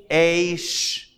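"Aish" held long on the vowel; slow sounding-out. Shown as a demonstration. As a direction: sound out "Aish" slowly: aaay-sh. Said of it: "go a little slow".

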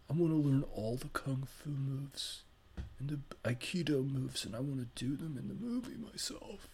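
soft spacey surfer-dude voice; Keanu impression.

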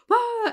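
sudden high mock shriek; short high gasped scream; retold startled scream. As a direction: yelp like someone caught off guard at the door. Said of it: "and she's like".

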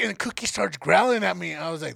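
normal talking voice

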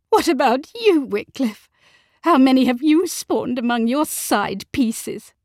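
high, loud accusation; stung wife on the attack; angry retort. A narrator's reading. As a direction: throw it back at him furiously. Wife hurls accusation back at husband.

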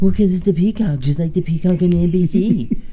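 high, put-on voice mimicking a friend; mock him in a silly high voice; mocking impression. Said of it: "I did not say it like that".

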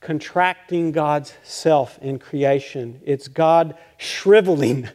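slow, deliberate emphasis; slow, emphatic. Acted. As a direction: draw out contracting and shriveling slowly. Preacher dwells on shriveling God.

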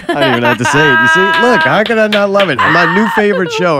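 high, loud gushing over the show; gushing excitement. Real, not acted. Gushing to friends about her new favorite show. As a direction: squeal with delight about it.

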